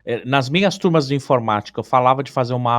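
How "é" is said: ordinary talk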